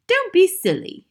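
high, loud wifely scolding; dismissive wife. A narrator's reading. Wife to hatted husband at home, dismissive. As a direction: brush him off in a high, loud voice.

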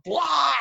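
sudden high-pitched "blah" yell; high mock toddler wail; imitated toddler outburst. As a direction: mimic a toddler's tantrum cry. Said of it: "the toddler has just gone".

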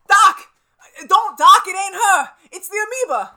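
yelled high and loud in alarm; frantic shout. Acted. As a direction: scream the warning to stop him. Warning Doc of the monster.

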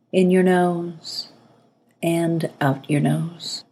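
calm breathing-exercise guidance, spaciously paced; guided meditation. Acted. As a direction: ease the listener into relaxing, phrase by phrase.

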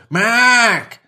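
high, loud Dublin call of a name; Dublin accent. Retold or imitated. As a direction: shout the name across the street in a Dublin accent. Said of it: "I need to be careful with how I do the accent".

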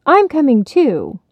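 responding hotly. Acted. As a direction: snap it back hotly. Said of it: "Heidi and Barney responded hotly".

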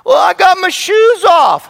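put-on high, slow whiny kid answer; child excusing himself to dad, high and whiny. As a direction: play the child making excuses.

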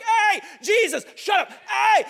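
slow, very high, very loud yelled call; desperate scream. Retold or imitated. Blind Bartimaeus screaming to Jesus over the crowd, full yell. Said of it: "he screamed all the more".

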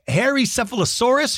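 ordinary talk

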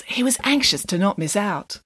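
ordinary delivery, nothing marked

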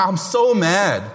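usual speaking voice

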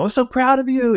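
a wife runs to her husband off the green, excited. Retold or imitated. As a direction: squeal it like a proud wife running up. Wife greets husband after loss.

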